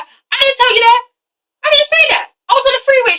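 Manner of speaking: high, frantic protest; panicked denial. Retold or imitated. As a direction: squeal it in disbelief. Pete panicking in retold scene.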